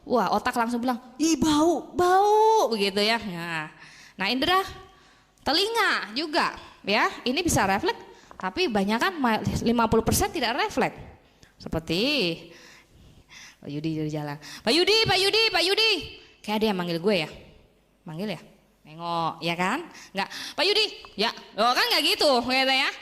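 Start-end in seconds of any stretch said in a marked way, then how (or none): 1.2-2.7 high, loud disgusted yuck; mock disgust. Acting a brain reacting to a foul smell, loud mock disgust. 14.6-15.9 high, loud call across a street. Acted. Someone on the street calling Pak Yudi, a high loud call. 20.5-20.9 high, loud call across a street. Acted. Someone on the street calling Pak Yudi, a high loud call.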